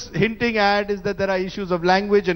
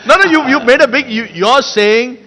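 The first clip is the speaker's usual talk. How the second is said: raised interrupting voice; 5 semitones higher, 9 dB louder than usual. Real, not acted. Host interrupting heated argument.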